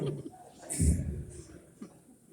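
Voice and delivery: breathy cracking-up laugh; long wheezy laugh; amused laugh. Real, not acted. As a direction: laugh hard at your own joke. Laughs at his own joke.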